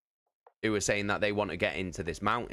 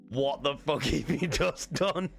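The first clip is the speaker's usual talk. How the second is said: higher, slow, outraged dragon bellow with a laugh breaking in; angry dragon voice; 7 semitones higher, slower than usual. Retold or imitated. Dragon confronting the party that woke it, mock outraged. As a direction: play the dragon roaring at the intruders, half laughing.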